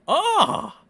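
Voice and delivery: high, loud fake cry; hammed-up "Ah!" of hurt; mock wail. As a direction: cry out in pretend anguish. Mock outrage after reading insult.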